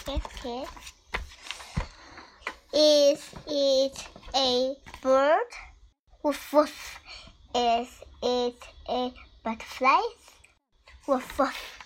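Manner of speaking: gentle, sing-song picture book read; reading to a toddler. Acted. Parent reads Biscuit book to child.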